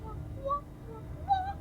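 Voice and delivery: rising high playful whoops; whooping cheer. Real, not acted. Cheering climbing higher.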